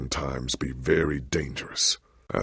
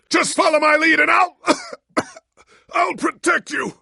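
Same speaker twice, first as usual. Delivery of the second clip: strained heroic shout; 5 dB louder than usual. Acted. Coughing mid battle cry.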